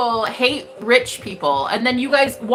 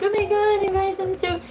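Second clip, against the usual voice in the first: mock teary whine, higher; lightly playing her earlier tearful self, pitched up; a hint of past tears in a higher voice; imitating her crying self; 6 semitones higher, faster than usual. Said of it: "I went from crying, being like".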